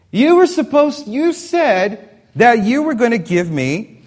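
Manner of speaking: put-on high, loud tearful child's wail; loud, high, tearful child's protest; crying child. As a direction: wail it like a little girl denied ice cream. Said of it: "Tears are streaming down Mia's face".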